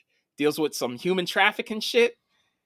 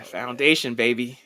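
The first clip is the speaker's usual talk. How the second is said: normal talking voice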